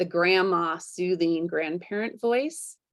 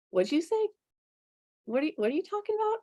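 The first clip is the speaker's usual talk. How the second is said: much higher, fast, playful asking; mock curious; 11 semitones higher, 3 dB quieter, much faster than usual. Talking back to her gentle voice, much higher and fast. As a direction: perk up like you barely heard. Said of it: "get curious with that voice".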